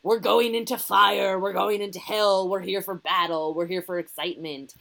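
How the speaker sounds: rally the troops in mock-heroic style; mock battle hero. Mocking men's songs in musicals, mock rousing battle cry. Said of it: "all the men's were like".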